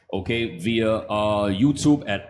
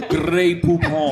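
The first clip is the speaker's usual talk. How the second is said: slow, loud fake French; fake French accent; 5 semitones higher, 4 dB louder, slower than usual. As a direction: drawl it like a hammy Frenchman.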